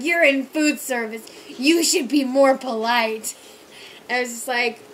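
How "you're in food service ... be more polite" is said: loud, slow scolding; lecturing drunk. Retold or imitated. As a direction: talk down to the waitress like a drunk. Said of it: "He's like".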